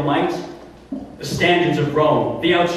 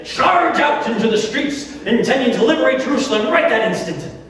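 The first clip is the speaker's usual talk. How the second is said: high-pitched, swept-up ranting; mock fervour; 8 semitones higher than usual. Governor describing rioters charging the streets, high mock fervour. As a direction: build to an exasperated high pitch.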